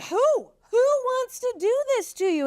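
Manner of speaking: high-pitched outraged mock demand; a storyteller's hammed-up furious king; angry king. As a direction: play the king, shocked and furious. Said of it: "King Ahasuerus is like".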